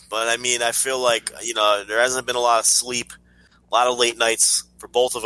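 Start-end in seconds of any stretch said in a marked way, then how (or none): none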